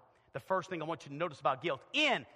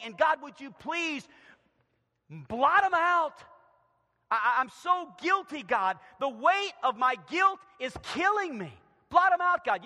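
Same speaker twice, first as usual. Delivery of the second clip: loud, raised pleading prayer; anguished plea; 8 semitones higher, 9 dB louder than usual. Acted. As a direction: beg God urgently from the pulpit. Acts David pleading with God.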